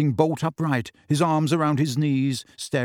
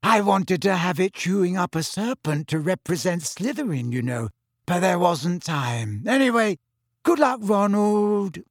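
put-on dreamy girl voice; 5 semitones higher than usual. Luna wishing Ron luck, dreamy and higher. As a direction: drift through the words, unhurried.

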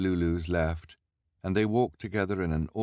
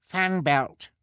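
Sunny reminding; 13 semitones higher, 6 dB louder than usual. A narrator's reading. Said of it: "as quietly as she could".

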